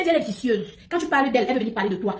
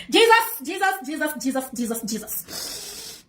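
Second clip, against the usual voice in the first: fading exclamation; 2 semitones higher, much slower than usual. Real, not acted.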